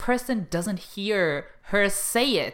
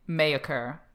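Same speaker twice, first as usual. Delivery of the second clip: ordinary talk, nothing marked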